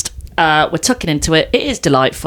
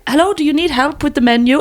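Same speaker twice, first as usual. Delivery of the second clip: higher-pitched imitation of a German woman; mock German accent, pitched up; 7 semitones higher than usual. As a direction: put on a helpful German accent. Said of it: "sounded German".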